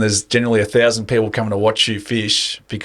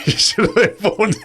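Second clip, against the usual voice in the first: laughing; 10 semitones higher than usual. Real, not acted. Teasing friend, laughing mid-sentence.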